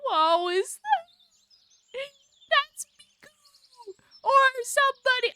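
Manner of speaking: high, loud startled cry; shocked. Real, not acted. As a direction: gasp it out in shock. Shocked seeing Miku.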